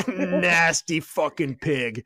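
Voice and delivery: slow, drawn-out disgusted sneer; mock disgusted drill instructor. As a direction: drag each word out in disgust. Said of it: "And he's like".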